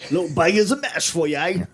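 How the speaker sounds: quick, hammed-up Cockney; Cockney accent. Joining friends in a Cockney bit, hammed-up Cockney accent. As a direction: rattle it off as a cartoon Cockney. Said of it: "when you guys do the accents".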